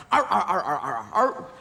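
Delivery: growling arr like a dog; mock dog growl; dog impression. Shown as a demonstration. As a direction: gnaw and growl at the apple. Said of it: "always do the dog impression first".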